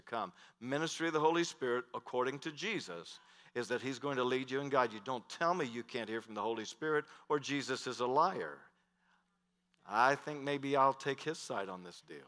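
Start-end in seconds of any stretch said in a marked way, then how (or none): none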